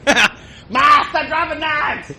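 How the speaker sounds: high, slow shout to another room; yelling to mom. Pretend. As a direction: call out to mom. Yelling to his mom offstage.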